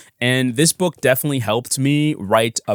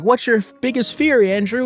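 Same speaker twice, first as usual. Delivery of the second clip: high, bright-eyed presenter question; pitched-up perky character voice; chirpy show host; 11 semitones higher than usual. Acted. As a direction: quiz the guest like a kids' host. Voicing kids' show host asking guest.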